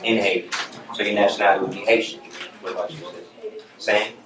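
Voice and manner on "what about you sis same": usual speaking voice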